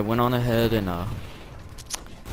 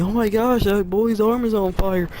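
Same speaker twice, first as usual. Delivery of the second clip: high, loud cry of alarm; drawn-out, high-pitched mock yell; imitated alarmed yelling; 10 semitones higher, 5 dB louder than usual. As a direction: mimic shocked onlookers raising the alarm. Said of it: "starts yelling at me".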